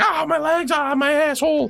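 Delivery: high-pitched mock wail of pain; mock screaming. Game master voices fallen players screaming, mock screaming. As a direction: wail as if hurt, without real volume. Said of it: "they start screaming".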